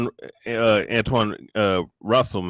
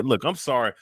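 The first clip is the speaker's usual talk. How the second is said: ordinary delivery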